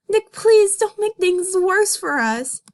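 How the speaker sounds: dramatic reading plea; mock pleading. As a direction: beg him to stop, hammed-up. Judy pleading.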